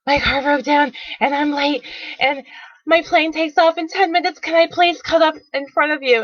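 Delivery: mock out-of-breath pleading to strangers; panting plea. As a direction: rush it out like you're late and desperate. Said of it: "I'm panting as I'm asking people".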